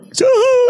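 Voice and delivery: long high mock sob; imitated crying wail. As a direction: howl like a man bursting into tears. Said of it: "he was crying for his box of tissues".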